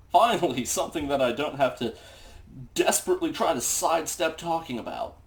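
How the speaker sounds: ordinary talk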